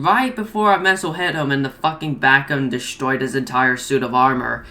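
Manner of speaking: normal talking voice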